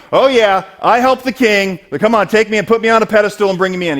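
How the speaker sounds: loud, high self-important crowing; mock boasting. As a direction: play a braggart demanding the pedestal. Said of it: "He's not going".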